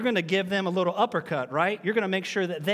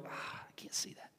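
breathy whispered aside; very quiet, whispered mock complaint; mock dazzled reaction; 20 dB quieter than usual. Acting out shielding eyes, dismissed.

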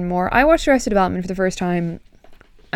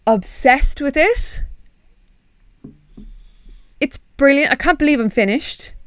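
excited; 5 semitones higher than usual. Real, not acted. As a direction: rave about it. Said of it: "gushing about show".

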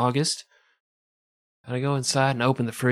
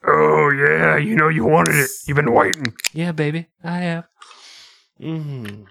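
mock seductive; 3 semitones higher, 4 dB louder than usual. Seducing the seltzer can.

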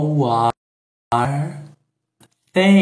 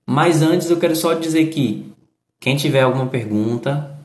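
ordinary talk

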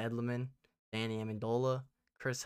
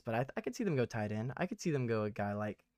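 ordinary talk, nothing marked

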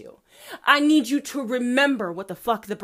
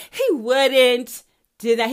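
louder, slow, each word pressed; loud insistence; 8 dB louder, slower than usual. Real, not acted. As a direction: declare it firmly and slowly. Insisting God makes no mistakes.

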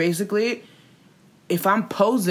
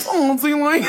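high-pitched mocking imitation; his apology voice; 7 semitones higher, 3 dB louder than usual. Imitating his apology to Tati, mockingly. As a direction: put on a whiny apology-video voice. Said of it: "this is literally how he talks".

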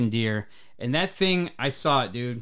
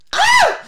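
sudden high shriek of fright; girly scream; 12 dB louder than usual. Retold or imitated. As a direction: shriek like a startled little girl. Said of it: "I screamed like a little girl".

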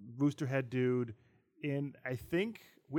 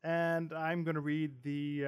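usual speaking voice

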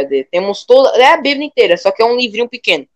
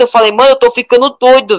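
ordinary talk, nothing marked

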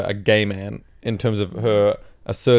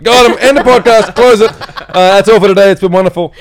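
excited; 9 semitones higher, 10 dB louder than usual. Acted. Jokingly ending podcast.

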